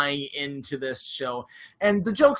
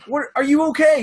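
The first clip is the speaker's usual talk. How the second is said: high, loud worried shout; shouting through door; 10 semitones higher, 8 dB louder, faster than usual. Retold or imitated. Staff shouting through the door at him, loud worry.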